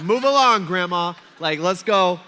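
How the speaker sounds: loud, impatient heckle; mock road rage. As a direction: holler at a slow driver, in jest. Retold taunt at old driver.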